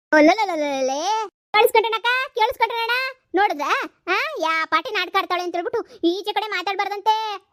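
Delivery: mother mocks the in-law to the villagers, loud and high; mocking outcry.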